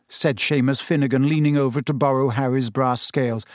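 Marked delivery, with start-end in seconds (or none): none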